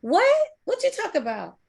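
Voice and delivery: high incredulous what; mock surprise. Playing the quitter who is baffled by the question, high and surprised.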